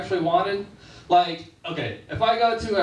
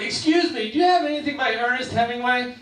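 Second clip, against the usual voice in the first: higher, quick, prim asking voice; polite request; 6 semitones higher than usual. Retold or imitated. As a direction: ask sweetly like a shy library patron. Polite request to librarian, high voice.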